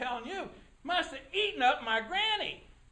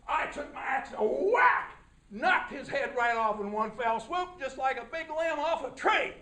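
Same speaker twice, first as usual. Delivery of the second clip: usual speaking voice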